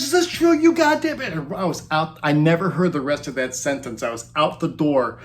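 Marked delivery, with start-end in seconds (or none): 0.0-1.3 much higher, louder furious accusation; imitating an angry demand. Angry boyfriend confronting the narrator, imitated high yell. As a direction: bark the question like a man about to swing. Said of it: "he says".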